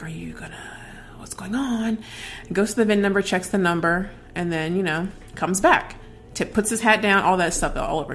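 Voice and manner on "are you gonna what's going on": unvoiced nervous whisper. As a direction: whisper it under your breath. Whispering to herself in car.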